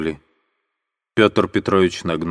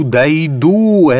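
higher and louder second cry; desperate shout; 7 semitones higher, 6 dB louder than usual. Acted. Katerina vowing to reach the tsar, loud falling shout. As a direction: hurl the repeat out loud.

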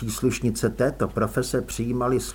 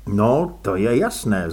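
plain reading voice

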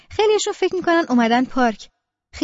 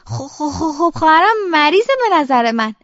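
halting, stuttered reply; stuttering; 3 semitones higher, 4 dB louder than usual. Acted. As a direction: stammer on the first word, then push the sentence out. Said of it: "لکنتی هم که داری". (با لکنت زبان)

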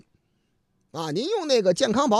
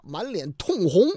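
ordinary talk, nothing marked

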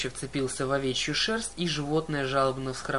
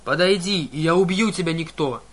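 furious threat; 2 semitones higher, 7 dB louder than usual. A narrator's reading. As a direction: bellow the threat in rage. Enraged cyclops threatening.

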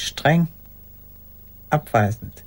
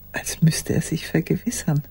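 whisper. Acted. Telling how he stared into the mirror: whisper. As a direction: drop into a whisper as he checks he still exists.

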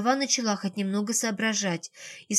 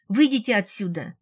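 plain reading voice

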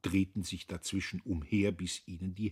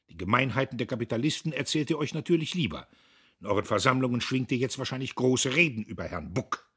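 loud, fast, raised voice, lecturing; Dietrich's blustering tirade; 5 semitones higher, 9 dB louder, faster than usual. A narrator's reading. Factory owner scolds his foreman, loud and fast. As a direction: scold the worker from up high.

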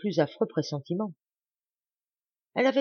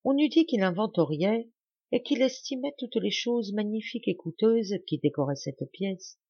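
ordinary delivery

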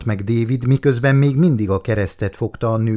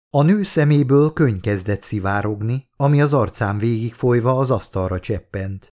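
usual speaking voice

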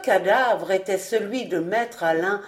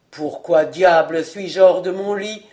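put-on drunk old man; 3 semitones lower, 4 dB louder than usual. A narrator's reading. Drunk majordomo wakes.

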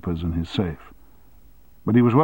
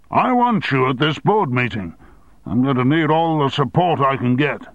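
higher, louder insistence; forceful demand; 6 semitones higher, 3 dB louder than usual. Acted. Chairman demanding his deputy come in, forceful. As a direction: press him hard.

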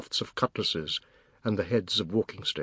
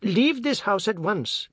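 slow, high, sharp order; stern command; 9 semitones higher, slower than usual. A narrator's reading. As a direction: order him out, slowly. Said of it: "Bisunde said".

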